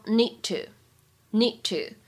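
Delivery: "need to" slow, the d held with no burst; unreleased d. Shown as a demonstration. Teacher showing "need to" with no released d, slow. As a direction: drop the d burst in "need to", slowly. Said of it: "完全失去爆破".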